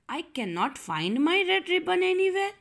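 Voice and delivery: put-on high, hurried little girl; child in a hurry. Teacher voicing Sarah who can't find her ribbon, hurried. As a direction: read it like a child in a rush. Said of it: "children were always in a hurry".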